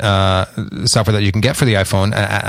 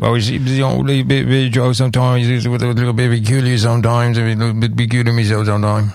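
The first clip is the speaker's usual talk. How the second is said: mock-incoherent rambling, run together; slurred impression of garbled speech; garbled rocker mumble. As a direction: mumble it like a hard-to-understand rocker. Mimics [name]'s garbled speech.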